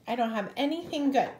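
usual speaking voice

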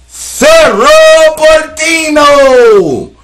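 high, loud, slow stretched shout; hyped shout. Real, not acted. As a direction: belt the name out like a goal call. Shouting a team name.

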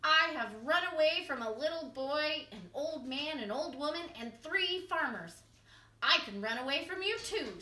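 lightly voiced boastful cookie; a hint of cheeky bragging; gingerbread man voice. A narrator's reading. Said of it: "said the gingerbread man".

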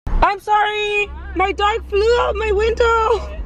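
high, slow, drawn-out call; panicked apology. Real, not acted. Owner apologizing after dog flew out window, high panicked call.

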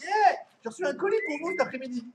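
high-pitched quick impression of a neighbour; imitated neighbour. Neighbour interrupts Game of Thrones with a parcel, high mock call.

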